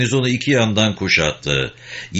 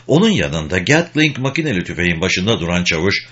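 usual speaking voice

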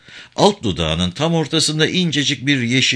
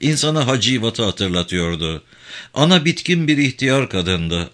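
ordinary delivery

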